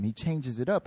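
usual speaking voice

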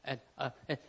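soft, low, hesitant fumbling for words; halting stammer. Acted. Tom Cruise facing the silent room, soft halting stammer. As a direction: falter quietly between long pauses. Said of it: "stuttering 'cause he's trying to".

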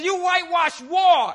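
high, loud cry of Paul struck in the mouth; Paul's outburst. Acted. Paul shouting back at the high priest after being struck. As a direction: shout it out as the wronged apostle.

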